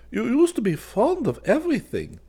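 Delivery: a hint of a fussy old craftsman; lightly voiced old man. A narrator's reading. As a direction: needle him gently as an old friend. Old man teasing narrator.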